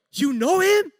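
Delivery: loud, high, incredulous question; amazed. Retold or imitated. Coworker learning the wife knows his guide, amazed. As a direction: blurt it out in high astonishment.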